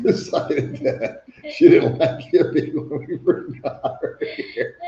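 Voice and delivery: slow, high, laughing storytelling; talking through laughter. Real, not acted. Laughing while telling dog story.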